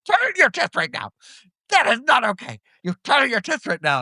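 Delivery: high, stern indignant demand; indignant teacher. Retold or imitated. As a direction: imitate the teacher scolding. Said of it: "She's like".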